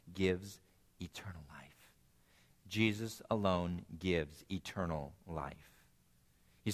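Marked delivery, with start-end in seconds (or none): 1.0-1.9 breathy whisper on eternal life, dropping far softer; whispered ending. Acted. Repeats the sermon point twice. 2.7-5.7 slow and deliberate, each word weighed; slow emphatic restatement. Acted. Repeats the sermon point twice.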